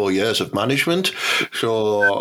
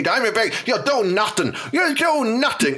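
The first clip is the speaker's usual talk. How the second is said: much higher, jeering taunt; fighter taunting; 9 semitones higher than usual. Retold or imitated. Fan mimicking a cage fighter taunting his opponent, high jeering. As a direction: goad the opponent, sing-song and cocky.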